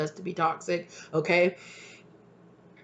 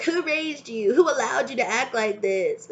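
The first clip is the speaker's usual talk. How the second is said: mocking elders; 6 semitones higher than usual. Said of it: "people are like".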